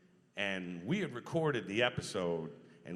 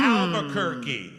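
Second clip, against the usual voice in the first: "Albuquerque" drawn out slowly, louder, with a laugh; silly drawl; 6 semitones higher, 13 dB louder, slower than usual. Pretend. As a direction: stretch out "Albuquerque" like a goofy joke. Repeats city in silly voice.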